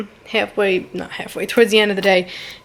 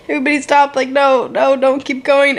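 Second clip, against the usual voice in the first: higher-pitched mock protest; imitated urgent halt; 5 semitones higher, 3 dB louder than usual. Retelling actor who messed up a scene, raised-pitch protest. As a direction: act out stopping everyone mid-take.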